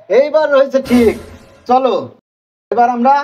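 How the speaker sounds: ordinary talk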